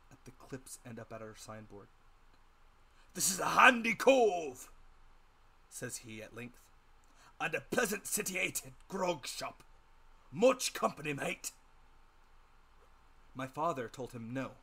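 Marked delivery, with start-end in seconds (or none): 3.1-4.7 high, loud, slow rough sailor's voice; old sea captain. A narrator's reading. 7.4-11.5 high, loud, slow rough sailor's voice; old sea captain. A narrator's reading. Captain's voice continues.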